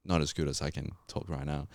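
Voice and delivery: ordinary talk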